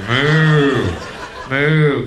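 loud, long imitated moo; mooing cow. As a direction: bellow like an arrogant cow. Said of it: "A couple of cows are getting arrogant".

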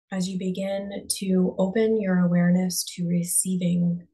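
calm, unhurried meditation voice with long pauses; guided meditation. Real, not acted. Coach guiding listeners to close their eyes and breathe, guided meditation. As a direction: ease them inward, leaving space after each phrase.

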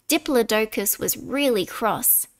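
usual speaking voice

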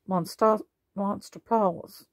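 ordinary delivery, nothing marked

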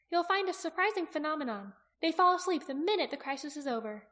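high-pitched, lilting know-it-all voice; knowing young Aki. Acted. Aki explaining sleeping dragon to Jaqen, high knowing voice.

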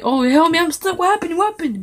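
mock panic. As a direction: pretend to be stuck and confused. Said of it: "Then like".

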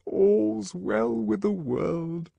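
slow, high, drawn-out intoning; high, slow, reverent singsong; pious murmured maxim. A narrator's reading. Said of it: "murmured the D H C".

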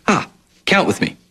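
usual speaking voice

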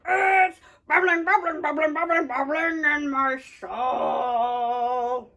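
lightly gruff pirate singing. Pretend. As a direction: sing like a pirate. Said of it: "pirate voice".

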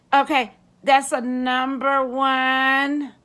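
slow, drawn-out cashier voice; mock drive-thru worker. Drive-thru worker repeating the order slowly and lazily. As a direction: drag it out like a bored worker.

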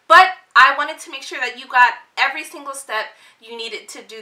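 bright, fast YouTube outro energy; vlogger. Acted. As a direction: pump it up like a vlogger signing off. Upbeat vlog outro to viewers.

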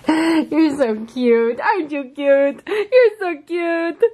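very slow, drawn-out coo. Real, not acted. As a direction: stretch each word for the puppy. Cooing at the dog.

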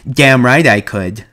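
loud, slow, proud assertion; emphatic agreement. A narrator's reading. Lenny assures George he could feed rabbits, emphatic. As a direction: come in strong and sure.

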